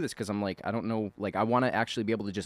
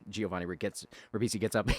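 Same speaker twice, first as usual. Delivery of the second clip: normal talking voice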